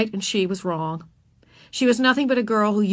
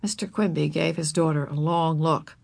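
usual speaking voice

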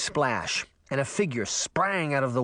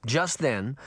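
ordinary delivery, nothing marked

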